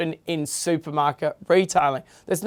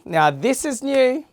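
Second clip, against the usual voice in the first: high, bright, rising on new; excited; 8 semitones higher than usual. Shown as a demonstration. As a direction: sell the word new like an ad.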